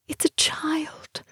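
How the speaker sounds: high, soft, slow disbelief; a hushed, high-pitched thought of slow disbelief. A narrator's reading. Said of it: "she thought with disbelief".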